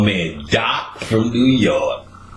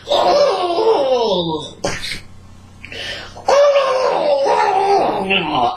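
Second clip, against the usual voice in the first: imitated retching. Imitating Doc's weird throw-up sound, high retch. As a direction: mimic a weird vomiting heave. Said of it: "that throw up sound, but it was a weird sound".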